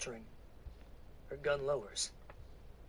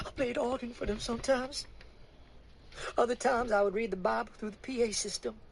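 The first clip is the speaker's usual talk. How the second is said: higher, tearful Southern woman's voice; weary woman; 8 semitones higher than usual. Acted. Woman confiding to the preacher, high and tearful. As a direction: let the grief weigh on it.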